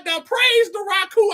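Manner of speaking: high, mock holler; imitated hollering. Host retells woman praising the Raku, mock hollering. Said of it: "while she was hollering".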